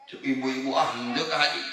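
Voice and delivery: ordinary delivery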